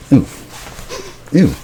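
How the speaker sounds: normal talking voice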